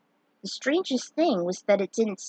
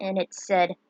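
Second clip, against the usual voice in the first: plain reading voice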